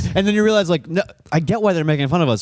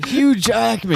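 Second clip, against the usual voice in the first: higher-pitched sudden yell; yelled name; 6 semitones higher, slower than usual. Real, not acted. Friend cutting into the movie argument with a yelled name. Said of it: "You just yelled, "Hugh Jackman".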